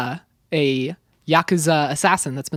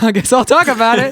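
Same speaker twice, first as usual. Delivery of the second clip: laughing through the words, higher and louder; 6 semitones higher, 6 dB louder than usual. Real, not acted. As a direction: giggle it out. Laughs while taking the turn.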